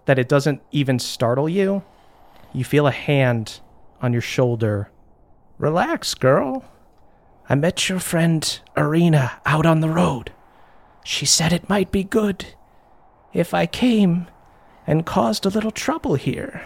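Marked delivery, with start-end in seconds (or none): 5.6-16.6 lightly voiced mischievous rescuer; calm stranger. Invisible stranger reassures the tied-up girl, calm. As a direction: reassure the girl as an unseen newcomer. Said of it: "a calm hand. It's so gentle".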